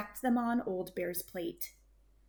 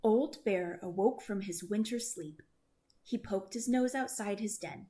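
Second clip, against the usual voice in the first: usual speaking voice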